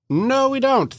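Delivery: very high playful no; mock reassurance. Giver tells friend no payback needed, high playful. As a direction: brush off the debt lightly.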